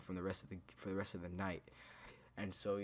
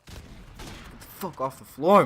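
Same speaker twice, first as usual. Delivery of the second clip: sudden loud, higher-pitched scolding; sharp shouted order; 6 semitones higher, 15 dB louder, much slower than usual. Real, not acted. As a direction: yell it out, dragging the words. Sudden real order mid-story.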